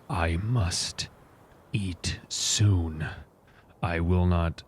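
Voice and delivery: slow, low, soft solemn vow; measured speech with heavy pauses; in-character war leader. Acted. Leader confessing hunger to his warriors, solemn and slow. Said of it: "I will address them honestly and say".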